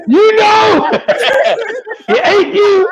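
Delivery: high, squealed through laughter; laughing tease. Real, not acted. Host teasing colleague over the joke, laughing high tease. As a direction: crack up while teasing, voice high.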